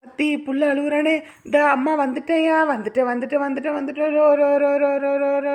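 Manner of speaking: high, loud, repetitive soothing; quick reassurance turning into a chanted lullaby noise; hushing a baby. Acted. Mother rushes to her crying baby, high loud hushing.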